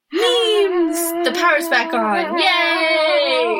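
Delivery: high, loud hammed-up cheer; mock glee. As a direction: imitate a friend squealing over memes. Mimicking friend's excitement.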